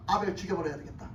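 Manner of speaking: low, soft voicing of a murderous thought; dark inner thought. Preacher voices Cain's thought of killing Abel, low and quiet. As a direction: think it aloud, low and quiet. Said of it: "가인이 뭐라고 생각을 해요".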